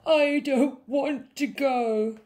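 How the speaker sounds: high, loud, slow whine; sobbing. A narrator's reading. Princess dreading the sleepover, sobbing. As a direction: wail it like a tearful child. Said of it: "sobbed the little princess".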